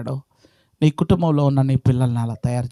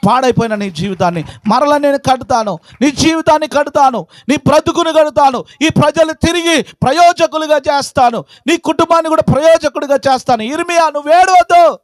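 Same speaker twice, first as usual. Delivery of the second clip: high, driving promise building to a cry; full-force preaching; 11 semitones higher, 5 dB louder than usual. Acted. Preacher voicing God's promise to rebuild lives, full-force proclamation. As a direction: hammer out each promise from the pulpit.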